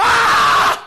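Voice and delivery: long, high, loud "aaah" scream; full-force yell. Shown as a demonstration. Pastor showing the young man how to shout, full-force yell. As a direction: demonstrate a yell with everything you have. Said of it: "제가 가리켜서 소리 지르는 거".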